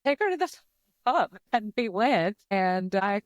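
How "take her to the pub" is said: much higher, exasperated parents giving in; mock parental order, pitched way up; imitating parents. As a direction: parents finally relenting. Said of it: "your mom and dad were like".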